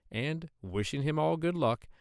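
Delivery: ordinary delivery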